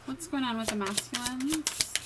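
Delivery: put-on the same question retried with a British accent. Reader asks Spirit again in a mock British accent. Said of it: "My British accent".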